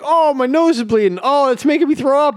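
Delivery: high-pitched cartoon distress; mock panicked wail. Imitating the cartoon dad sick at both ends, panicked. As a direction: cry out as one disaster follows another. Acting out dad's escalating emergency.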